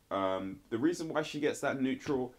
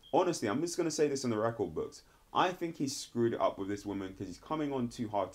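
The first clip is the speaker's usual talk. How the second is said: ordinary talk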